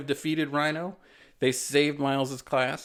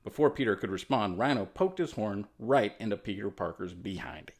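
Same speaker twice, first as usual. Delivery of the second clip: ordinary delivery, nothing marked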